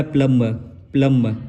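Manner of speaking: प्लम as "plumma", b dropped; silent b. Shown as a demonstration. Teacher repeating the correct reading, silent b. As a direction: drop the b, short vowel after m in प्लम. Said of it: "ये जो ब है वो निकल जाएगा".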